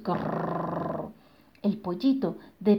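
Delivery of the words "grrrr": breathy low growl; giant growl. Acted. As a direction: growl softly from afar. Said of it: "se oían los gruñidos". (gruñido de gigante lejano)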